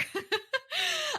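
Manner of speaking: a sudden high little laugh; high giggle. Real, not acted. Host giggles before asking listeners a favor, light laugh.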